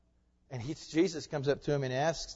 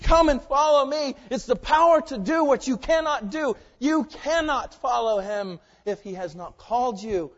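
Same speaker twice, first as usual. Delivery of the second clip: loud, high impassioned preaching; preaching at full force; 8 semitones higher, 11 dB louder than usual. Acted. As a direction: thunder it from the pulpit.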